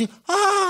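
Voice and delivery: high startled cry; shocked gasp. Retold or imitated. Boy shocked by the saw scene, high yelp. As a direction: yelp in mock horror.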